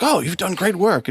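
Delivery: imitated officer praising, pitched high; older military guy. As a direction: lift the voice for an impressed old officer. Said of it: "this older military guy comes in".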